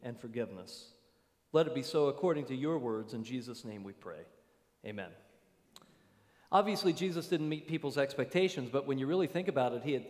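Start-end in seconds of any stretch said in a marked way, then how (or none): 0.0-5.1 soft, reverent prayer voice; closing prayer. Acted. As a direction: close the prayer quietly and humbly. Closing prayer before sermon.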